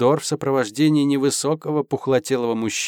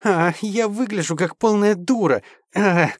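high, anguished half-growl; half-groaning wail; 8 semitones higher than usual. A narrator's reading. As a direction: wail in frustrated despair. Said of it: "с утробным полурыком, полустоном".

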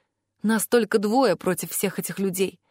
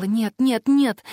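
plain reading voice

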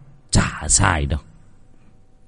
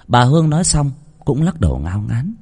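usual speaking voice

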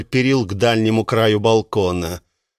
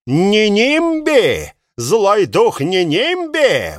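slow, high furious shriek; seething shrieking spirit; 7 semitones higher, slower than usual. Acted. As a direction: scream the name in rage. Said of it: "Мэр взбешён, мэр вне себя".

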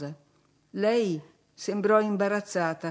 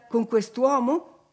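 slow, louder, incredulous; indignant disbelief; 5 semitones higher, 5 dB louder, slower than usual. A narrator's reading. Nelly questioning Catherine about the marriage, incredulous. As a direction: stretch out the outrage. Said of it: "chiesi io".

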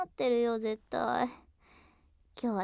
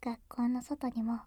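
pillow talk; 9 dB quieter than usual. Acted.

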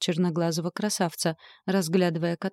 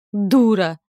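much louder, drawn-out roared insult; angry roar; 2 semitones higher, 9 dB louder than usual. A narrator's reading. Death hurling an insult in the chase, roaring. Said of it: "взревела я".

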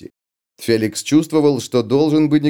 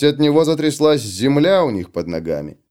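usual speaking voice

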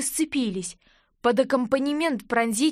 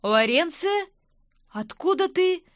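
high-pitched astonished question; taken aback at seeing her; rising disbelief; surprised; 6 semitones higher than usual. A narrator's reading. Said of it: "удивлённо вопросил".

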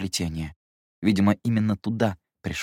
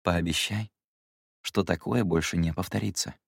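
softer, calm instruction; calmer; 2 semitones lower, 4 dB quieter than usual. Acted. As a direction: settle down and ask gently. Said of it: "Люмьер более спокойно продолжил".

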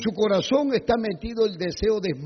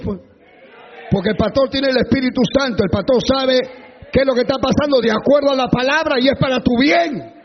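ordinary talk, nothing marked

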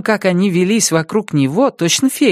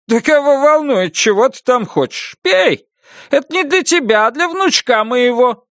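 bossy jovial grandpa; 7 semitones higher than usual. Acted. Grandpa dismissing her, cheerfully bossy.

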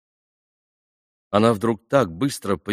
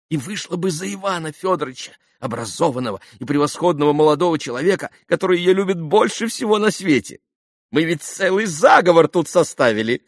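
high, effusive, excitable lady; gushing society mother; 8 semitones higher, 4 dB louder than usual. A narrator's reading. Khokhlakova confides the family plot to Alexei, gushing and high. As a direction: pour it out in rising, rapturous confidence.

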